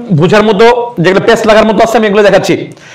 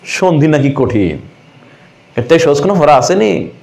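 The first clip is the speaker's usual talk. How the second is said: mock complaining student; 5 semitones lower, 3 dB quieter than usual. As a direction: drag it out softly like a pupil's mock complaint. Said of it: "ফুলাফানা কি কয়".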